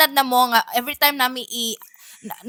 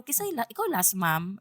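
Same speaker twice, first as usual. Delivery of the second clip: softer, polite question to a stranger; 3 semitones lower, 10 dB quieter than usual. Retold or imitated. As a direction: ask gently and politely. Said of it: "Ah, butan kayo".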